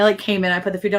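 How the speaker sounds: ordinary talk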